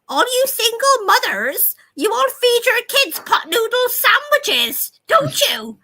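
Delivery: very high, loud mock old-lady voice; mocking old woman. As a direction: sneer it like a nasty old biddy. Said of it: "She's an old, racist, nasty bitch".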